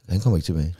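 low, quiet deadpan punchline; mock ominous. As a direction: drop into a dark deadpan.

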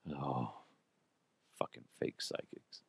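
scornful whisper. Real, not acted. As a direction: hiss it under your breath. Scornful aside.

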